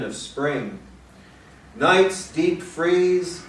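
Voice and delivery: slow, spacious poem recital; unhurried, weighted line endings; poetry reading. Acted. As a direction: linger on each image as you read to an audience. Reads a poem aloud.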